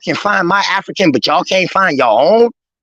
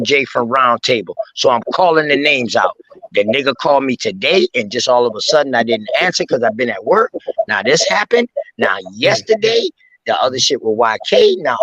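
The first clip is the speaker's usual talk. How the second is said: usual speaking voice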